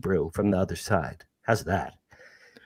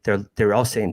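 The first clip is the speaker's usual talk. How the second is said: ordinary talk, nothing marked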